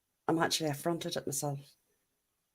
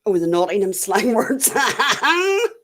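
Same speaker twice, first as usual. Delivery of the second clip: laughing through the words, louder and higher; 5 semitones higher, 9 dB louder, slower than usual. Real, not acted. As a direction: giggle while reading the comment. Laughing at chat comment.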